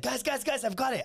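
high, eager eureka; acted-out urgent brainwave; excited interruption. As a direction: mimic a friend bursting with an idea. Cousin interrupts with an idea.

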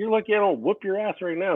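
high, heated threat; retold threat. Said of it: "And then I was like".

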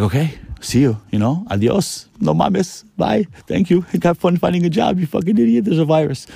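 high-pitched, sarcastic goodbye; mocking send-off. Boss to a worker who threatens to quit, mocking send-off. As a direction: climb high and wave them off with mock cheer. Acted send-off to someone quitting.

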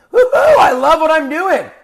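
big hammed-up cheer of delight; mock woo-hoo scream. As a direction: whoop it out like a cheering fan. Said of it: "I want you to scream".

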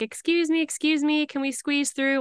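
high, polite "excuse me"; polite high plea. Retold or imitated. Pushing down a plane aisle.

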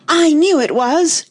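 high, loud, drawn-out wail; furious cry. A narrator's reading. Tweedledum finds his rattle broken, outraged cry. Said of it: "cried Tweedledum".

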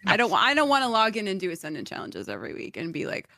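ordinary talk, nothing marked

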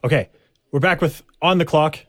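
normal talking voice

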